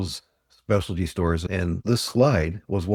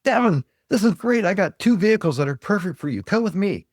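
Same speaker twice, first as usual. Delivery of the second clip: much higher and louder, gushing like a salesman who smells a sale; excited salesman; 10 semitones higher, 3 dB louder than usual. Retold or imitated. Salesman to a customer about two perfect SUVs, high and excited. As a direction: oversell it, all eager. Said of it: "he gets all excited".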